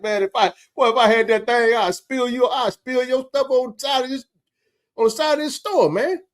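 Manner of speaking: high, heated threat; retold furious threat. Warning the boy outside, retold furious threat. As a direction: spit the threat at the kid. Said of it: "I was hot".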